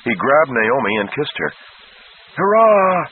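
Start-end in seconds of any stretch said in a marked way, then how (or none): 2.3-3.0 high, loud joyful shout; dizzy cheer. A narrator's reading. Dennis cheering the rescued prince, loud and high. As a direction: cheer the win with a grin. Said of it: "Dennis cried, grinning dizzily".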